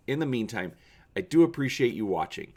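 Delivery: ordinary delivery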